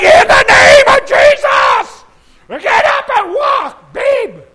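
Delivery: huge high-pitched revivalist shout, drawn out; Brother Schambach. Retold or imitated. Preacher imitating a fellow evangelist commanding healing, roaring shout. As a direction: bellow it like a healing preacher at full blast. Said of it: "But Brother Schambach doesn't say".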